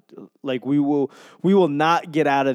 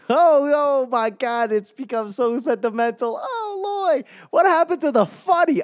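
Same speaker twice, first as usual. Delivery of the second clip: much higher, louder teasing falsetto heckler; mocking high voice; 6 dB louder than usual. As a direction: jeer at your own sappiness like a snarky onlooker. Mocks own sentimentality in another voice.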